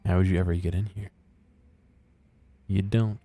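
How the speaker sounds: ordinary talk, nothing marked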